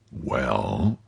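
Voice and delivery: low, soft, drawn-out question; expectant prompt. Acted. Musketeer waits for his friend's story, expectant prompt. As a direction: gently prompt him to go on.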